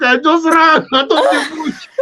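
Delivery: high laughing voice. Real, not acted. Recalling fleeing the bush, laughing. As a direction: laugh through the words.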